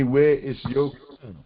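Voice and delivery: very slow, deliberate mock question; mimicking the journalist. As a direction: stretch "Where is your crowd?" out word by word, mocking. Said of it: "But he asked me".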